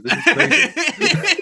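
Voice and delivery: laughing. Real, not acted. Laughing in disbelief.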